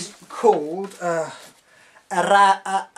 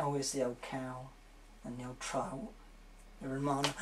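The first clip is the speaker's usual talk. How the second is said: much softer, lower muttering; muttered aside; 5 semitones lower, 17 dB quieter than usual. Real, not acted. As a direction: grumble it under your breath.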